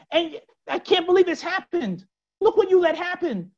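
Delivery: incredulous reproach, pitched well up; scolding. Retold or imitated. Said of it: "scolds the Kadosh Baruch Hu".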